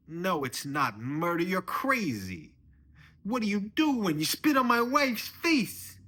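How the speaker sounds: high, loud angry yelling; outraged father-in-law. Acted. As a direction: bellow at the son-in-law in outrage. Acted father-in-law quarrels.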